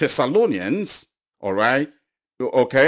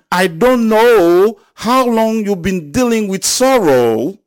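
loud, high, impassioned preaching; full-throated revival preaching; preaching at full force; 7 semitones higher, 8 dB louder than usual. Acted. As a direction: lift the congregation with a rising shout. Preacher builds to climax.

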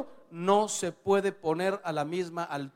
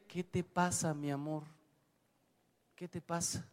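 soft, gentle, loving question; calm her with quiet tenderness; tender reply; 9 dB quieter than usual. Retold or imitated. Husband softly soothing his shouting wife, tender.

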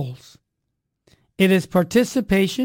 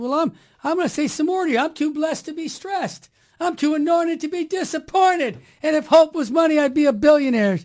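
high, bouncy preacher catchphrases; upbeat slogans; 7 semitones higher than usual. Retold or imitated. He cheers the shop lady with slogans, higher and upbeat. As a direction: rattle them off like a pep talk.

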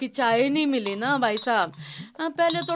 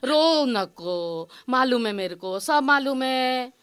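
consoling; 4 semitones lower than usual. Acted. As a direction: hush someone who is crying. Maid consoles crying employer. (दिलासा देते हुए, धीमे-धीमे)

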